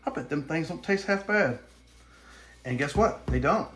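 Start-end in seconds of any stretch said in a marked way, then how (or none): 0.0-1.6 a much higher, put-on city boy voice that settles toward normal; mock city boy. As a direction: squeak it out like a clueless city boy. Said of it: "city boy out there that looks at a squirrel and just goes".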